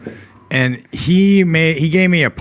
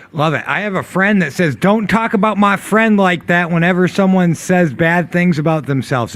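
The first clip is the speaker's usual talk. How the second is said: higher, louder put-on Southern voice; weird Southern accent; 5 semitones higher, 4 dB louder than usual. Reading John's comment to the group, weird Southern accent. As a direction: read it out in an odd Southern accent. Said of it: "gave John a weird Southern accent".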